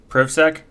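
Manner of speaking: PrivSec as "privv-sek", questioning rise; short-i pronunciation. Shown as a demonstration. Streamer testing a name's pronunciation, PrivSec as questioning "privv-sek". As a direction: try PrivSec with the vowel of "give". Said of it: "That's not how you pronounce".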